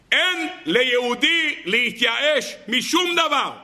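high, slow, shouted declaration; preacher shouting the quote to his listeners; fiery proclamation. Acted. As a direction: thunder it out to the congregation.

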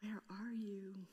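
breathy hushed call, slow; soft whisper. Acted. God calling to the man in a slow whisper. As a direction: whisper the question gently. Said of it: "the Lord God called to the man".

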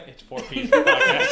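high laughing, slowed down. Real, not acted. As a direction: laugh through the words.

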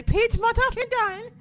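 high, loud mock British yell; UK fan impression. Shown as a demonstration. Insisting on the fan pronunciation, high British yelp. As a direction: squeal the name like a British fan. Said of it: "I have to say".

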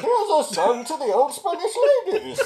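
high, laughing Quint impression; New England accent. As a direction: Quint sea shanty voice, cracking up. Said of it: "tried to still do the New England accent".

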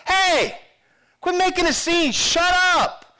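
loud, high telling-off; scolding. Acted. Crowd silencing the blind beggar, scolding. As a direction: shout him down crossly. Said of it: "when they're scolding".

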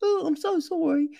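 high, sugary mock apology. Mocking a film character's apology, high and sweet. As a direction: parody a too-easy sorry. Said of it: "And then in just a".

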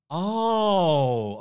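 ordinary talk, nothing marked

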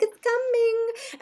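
very high, stretched-out singsong; singsong reassurance. Retold or imitated. Mother imitating the doctor mid-procedure, high singsong. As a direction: draw it out slowly in a high lilt. Doctor voiced as she works.

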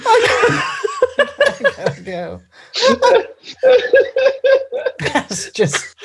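words squeezed out high between laughs; real fit of giggles, barely getting words out; helpless laughter. Real, not acted. Podcaster cracking up over the tomato joke, laughing helplessly.

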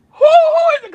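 very high, incredulous squeal; mock disbelief. Uncle reacting to nephew's cussing, high mock disbelief. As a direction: act baffled, voice shooting way up.

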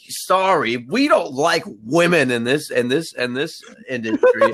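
mock sexist boss. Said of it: "the dude's like".

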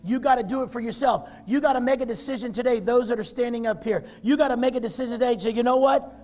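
loud, high, fast preaching; fiery altar-call preaching. Real, not acted. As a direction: press them to decide now. Preaching at full force.

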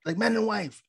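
high-pitched impatient mock hurrying; frustrated. Imitating Humperdinck cutting the wedding short, mock-frustrated. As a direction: rush the preacher along, voice jumping up. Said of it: "getting frustrated, so he's like".

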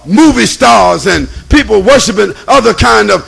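loud, fired-up preacher; preaching at full force. Acted. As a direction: build the sermon to a shout.

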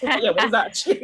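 giggling through a mock retort; laughing mock reply. Friend laughing while retelling her own comeback. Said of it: "I was like".

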